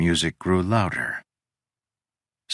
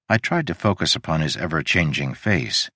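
ordinary delivery, nothing marked

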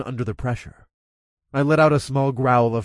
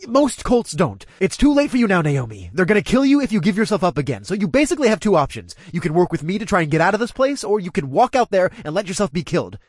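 urgent pleading; 7 semitones higher than usual. A narrator's reading. Narrator urging Naomi.

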